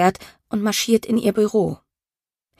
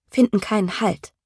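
ordinary delivery, nothing marked

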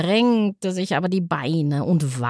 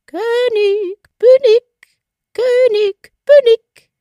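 very high, much louder piercing call, bin punched; shrill triumphant little bird shouting down; piercing bird cry; 8 dB louder, slower than usual. A narrator's reading. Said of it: "rief unten mit seiner durchdringenden Stimme". (durchdringender, sehr hoher Vogelruf, „bin“ betont)

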